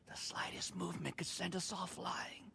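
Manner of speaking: tense hushed whisper, barely breathing. Acted. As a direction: whisper as if one move kills you. Frozen still, warning others.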